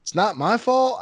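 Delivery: put-on high, pleading young girl's whine; mimicking the girl. Quoting the scammed girl as she protests in a high whine. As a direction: echo her helpless excuse in a high voice.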